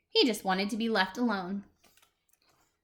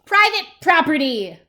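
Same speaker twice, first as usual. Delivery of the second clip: angry yell, loud and raised in pitch; Hank; 5 semitones higher, 11 dB louder than usual. Acted. Cactus warning off a tortoise, yelled. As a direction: yell it to warn off trespassers. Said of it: "yelled Hank".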